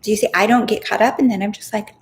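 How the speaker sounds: usual speaking voice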